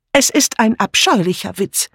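high-pitched, emphatic objection; energetic protest. A narrator's reading. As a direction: declare it with indignant energy. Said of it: "erklärte Dora energisch".

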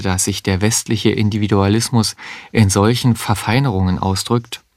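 plain reading voice

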